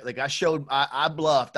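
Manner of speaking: ordinary delivery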